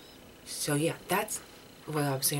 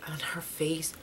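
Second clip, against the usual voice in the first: retold gasp. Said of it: "she legit gasped".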